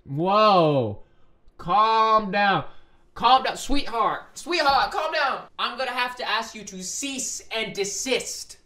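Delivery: loud, high scolding; flustered protest. Real, not acted. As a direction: order them to back off, flustered. Flustered reader scolds the character.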